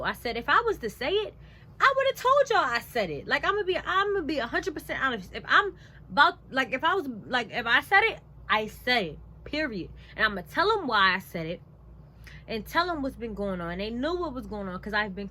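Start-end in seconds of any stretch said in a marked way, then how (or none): 0.0-9.9 loud, high-pitched self-defence; indignant retelling at raised volume; emphatic insistence. Retold or imitated. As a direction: push it out forcefully, protesting your honesty. Retells standing up to managers.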